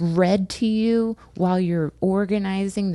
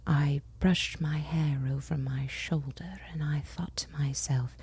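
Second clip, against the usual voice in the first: mock sultry audiobook whisper; 3 semitones lower, 7 dB quieter than usual. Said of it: "she was like".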